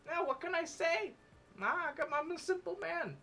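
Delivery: very high-pitched, swooning. Acted. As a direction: squeal it in a high falsetto. Swooning reply.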